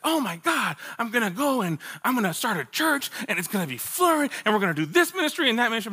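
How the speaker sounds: high, breathless rush of naive enthusiasm; mock gushing. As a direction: imitate starry-eyed students piling up big plans. Mimics eager fellow Bible college students.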